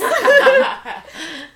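bursting into a high, loud laugh. Real, not acted. Cracking up at the correction, loud laughter. Laughing at own retold quote.

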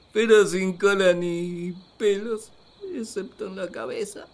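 ordinary delivery

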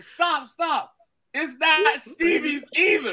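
high-pitched mock plea, drawn out at the end; acted pleading. Storyteller plays the woman stopping a beating, acted pleading. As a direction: imitate a desperate wrestling-storyline cry. Acting out Beulah's desperate plea.

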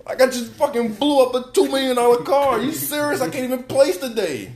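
high-pitched, indignant rant; hammed-up exasperation, loud and high; mock outraged driver. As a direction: imitate a racer fuming after a wreck. Voices the enraged driver.

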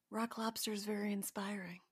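understated, soft aside; hushed deadpan. Real, not acted. Co-host gives her verdict on Rock Lobster, hushed deadpan. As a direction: drop to a near whisper. Mock-solemn verdict after the story.

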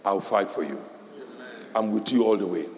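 low, soft reassurance; reassuring parent. Shown as a demonstration. Parent reassuring child after school, low and soft. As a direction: promise to fight for them gently. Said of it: "what you should tell the child".

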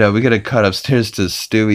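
ordinary talk, nothing marked

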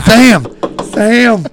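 loud urgent whisper-call to a friend. Retold or imitated. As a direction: hiss his name, urgent.

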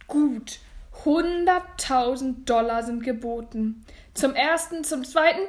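loud, weighty auction call; suspenseful hammer countdown; auctioneer announcing bid. Acted.

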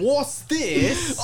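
high, loud mock English outburst; hammed-up English accent, laughing; aggressive English. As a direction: squawk it in exaggerated English. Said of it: "It's just aggressive English".

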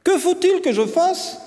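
put-on high-pitched, cocksure boy; confident child voice, pitched right up; self-assured. A narrator's reading. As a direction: ask it boldly, as if entitled. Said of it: "dit-il avec assurance".

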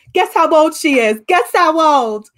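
loud excited call to the kitchen; waitress calling out. Retold or imitated. Waitress shouting to the kitchen staff, loud and excited.

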